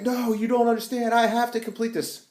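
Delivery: high, desperate hammed-up plea; dramatic pleading. Banner begging the agents to let him finish, high hammed-up plea.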